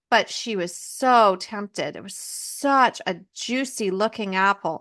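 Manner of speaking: normal talking voice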